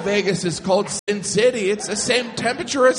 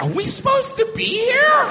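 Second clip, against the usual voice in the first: nervous tourist. Retold or imitated. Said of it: "I was like".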